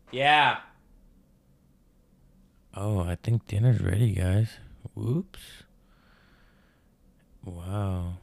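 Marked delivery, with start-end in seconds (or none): 0.1-0.6 loud shouted reply; yelling across the room. Real, not acted. Answering girlfriend across house. 2.7-4.6 loud, raised announcement; louder as if over distance; yelling across the room. Real, not acted. Dinner called from elsewhere.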